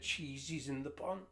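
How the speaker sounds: usual speaking voice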